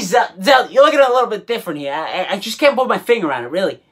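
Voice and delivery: usual speaking voice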